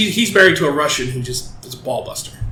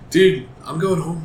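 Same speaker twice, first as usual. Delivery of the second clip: indifferent; 3 dB quieter than usual. Retold or imitated. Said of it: "he's just completely indifferent".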